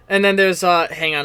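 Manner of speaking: ordinary talk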